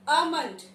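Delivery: "almond" with silent L, AH-mund; correct pronunciation. Shown as a demonstration. Teacher announcing the next word, correctly pronounced with silent L. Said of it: "the correct pronunciation is".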